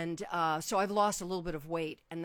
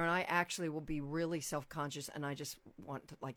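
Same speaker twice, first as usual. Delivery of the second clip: ordinary talk, nothing marked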